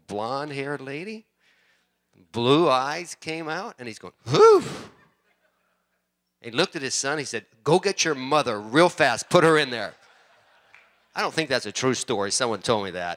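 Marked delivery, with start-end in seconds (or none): 4.3-4.6 loud, high "whew" of amazement; awestruck whoop. Retold or imitated. Preacher as the chief seeing the lady come out, awestruck whoop. 7.6-9.9 loud, acted-out urgent command; urgent order. Preacher as the chief ordering his son, urgent and loud.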